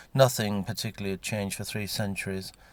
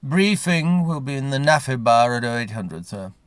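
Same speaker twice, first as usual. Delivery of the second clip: guardsman; 5 semitones higher, 7 dB louder than usual. Acted. Guardsman announces the briefing time.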